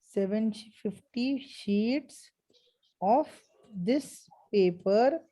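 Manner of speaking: very slow, word by word; slow dictation. Shown as a demonstration. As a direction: spell it out for notes. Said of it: "dictating the answer slowly".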